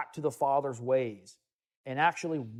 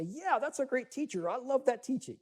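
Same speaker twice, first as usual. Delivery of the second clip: high, mock-gushing approval; sing-song mock approval of a teacher; mock praise; 9 semitones higher, 4 dB quieter than usual. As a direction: sweetly fake enthusiasm, pitched up. Mock quote of agreeable listener.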